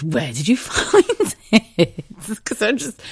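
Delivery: slow question cracking up; laughing. Real, not acted. Sally asking the customer, laughing slowly. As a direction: barely hold back laughter.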